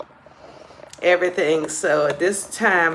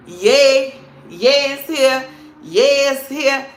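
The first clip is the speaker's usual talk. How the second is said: loud, high happy cheer; a joyful louder yay; excited cheering; 6 semitones higher, 5 dB louder, slower than usual. Real, not acted. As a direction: cheer the new year in. Celebrating the new year.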